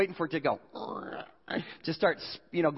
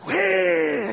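loud, high imitated cry; mock snake shriek; 9 semitones higher, 5 dB louder than usual. Preacher imitating the cobra that scared him, loud mock shriek. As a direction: shriek like a startled, striking snake.

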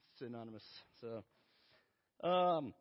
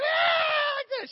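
very loud, high thrill-ride shriek; imitated girl's scream; 13 dB louder than usual. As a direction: shriek like a delighted child on a coaster. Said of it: "she's like".

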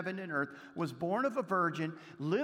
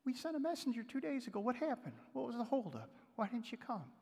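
higher, soft, plaintive questioning; implied reproach; 5 semitones higher, 5 dB quieter than usual. Retold or imitated. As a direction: voice a sister's hurt demand for answers. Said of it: "pick that up in the tone".

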